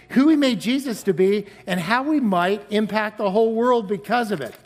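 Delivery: usual speaking voice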